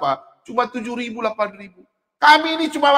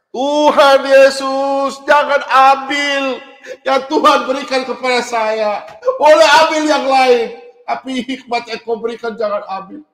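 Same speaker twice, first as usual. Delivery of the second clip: fervent prayer; 2 semitones higher, 4 dB louder, slower than usual. Retold or imitated. His vow prayer to Jesus.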